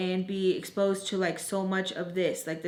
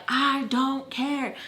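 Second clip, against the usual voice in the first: slow, higher-pitched mock yell; retold passionate scream; 4 semitones higher, much slower than usual. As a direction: draw it out, imitating a fed-up scream. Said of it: "I'm, like, screaming at her".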